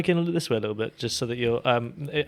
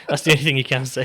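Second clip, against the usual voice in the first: ordinary talk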